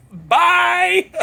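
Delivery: high, loud singsong bye; mocking bye. Racers leaving him behind, high loud mocking bye. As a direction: taunt them on the way out.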